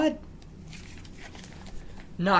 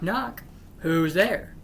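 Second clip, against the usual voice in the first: normal talking voice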